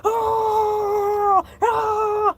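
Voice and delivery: long high-pitched "ah" wail; hysterical scream. Acted. Shark losing control while retelling, screaming. Breakdown mid-story.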